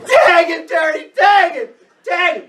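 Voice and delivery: rapid high shouts, one on another; the whole team yelling in crescendo; crowd crescendo yell. Retold or imitated. Teammates screaming to Terry to tag him, high urgent shout. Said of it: "everybody is in a crescendo".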